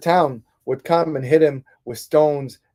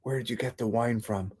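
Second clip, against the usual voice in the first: hushed, close to the ear; a secretive hushed question; whispered; 3 semitones lower, 10 dB quieter than usual. Retold or imitated. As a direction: lean in and whisper it privately. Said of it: "whispered in his ear".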